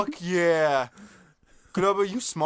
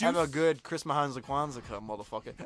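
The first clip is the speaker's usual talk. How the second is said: mock deep sign-off; 7 semitones lower, 5 dB quieter than usual. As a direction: drop the voice way down for the goodbye. Sign-off in low slow voice.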